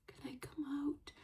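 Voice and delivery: tiny, high, very soft cat voice; hushed high-pitched pretend cat; timid cat. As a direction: squeak it softly, like a nervous cat testing whether it's safe. Voicing a nervous cat venturing out.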